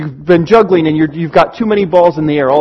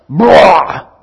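a loud exasperated "Blah!" blurted out; frustrated venting groan; 4 dB louder than usual. Retold or imitated. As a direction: dump it all out in one loud groan. Venting friend unloads frustration.

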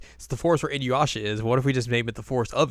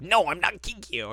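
higher indignant protest; mock protest; 8 semitones higher than usual. Retelling Kagome, higher mock protest to Inuyasha. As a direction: insist she's not Kikyo, mock-offended. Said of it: "She's like".

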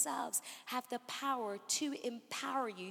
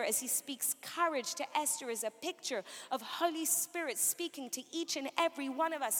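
ordinary delivery

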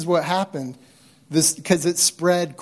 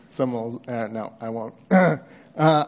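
ordinary delivery

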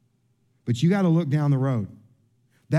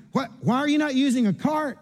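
mock wife asking in a higher voice; a higher, fussing wife voice; wife teasing him about the missing cart, higher; imitating wife, higher; 7 semitones higher than usual. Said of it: "she's like".

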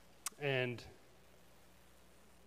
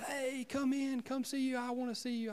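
frail dying man; 11 semitones higher than usual. Retold or imitated. Voicing dying friend James.

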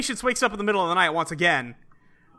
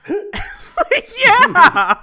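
high, loud laugh breaking into the word; can't stop laughing while quoting the answer; laughing through it; 9 dB louder, much slower than usual. Real, not acted. Retelling a movie scene to friends, laughing.

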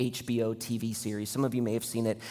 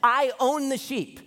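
impassioned shepherd voice; 9 semitones higher, 4 dB louder than usual. Retold or imitated. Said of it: "But he's like".

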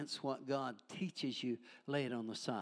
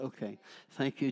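ordinary talk

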